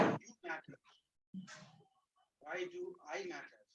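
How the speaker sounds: faint, distant voice; speaking too low to hear; barely audible. Real, not acted. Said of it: "[name], your voice is very low".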